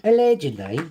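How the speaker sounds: put-on comic voice for the joke; funny voice. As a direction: twist it into a silly character voice. Repeating his running joke.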